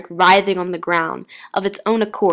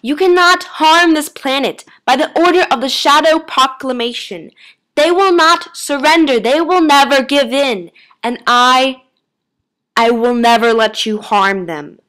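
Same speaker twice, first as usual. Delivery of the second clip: loud, raised, forceful declaration; defiant yell; 6 semitones higher, 8 dB louder than usual. A narrator's reading. Doctor defending the humans, loud defiant yell. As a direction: declare it loudly, easing slightly at the end. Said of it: "the doctor yelled".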